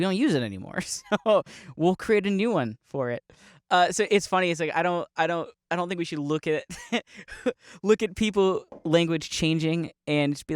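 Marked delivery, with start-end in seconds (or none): none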